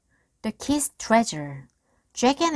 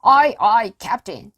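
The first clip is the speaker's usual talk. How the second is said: pirate reply; 4 semitones higher, 10 dB louder than usual. A narrator's reading. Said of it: "they answered".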